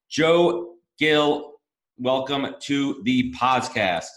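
loud, slow arena announcer intro; stage announcer. Acted. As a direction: stretch it out like introducing a rock band. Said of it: "like when they announce [name]".

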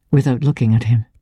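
ordinary delivery

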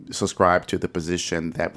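ordinary talk

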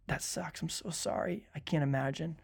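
soft, hushed sympathy; modelled condolence. Shown as a demonstration. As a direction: commiserate gently under the breath. Models what to tell a griever.

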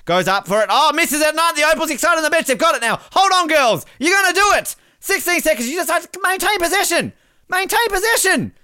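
high, loud play-by-play of the final seconds; excited live commentary. Acted. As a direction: call the last seconds like a breathless commentator.

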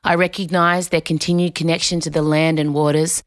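measured, solemn formal read; formal acknowledgment. Real, not acted. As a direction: read a respectful statement, evenly and deliberately. Reads an acknowledgment of country.

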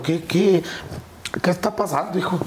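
high, pleading imitation of mother; anxious mother. Mother begs her son for news, imitated high anxious voice. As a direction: voice a mother pressing for answers.

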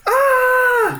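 high shrill death scream; dying scream. Acted. Guard screams as the bull charges him, high scream. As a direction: cry out as the bull hits you.

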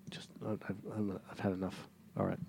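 sighing, whispered and fed up; exasperated whisper. Real, not acted. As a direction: breathe it out, worn out. Fed up with the topic.

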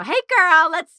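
playful mock-flamboyant call.

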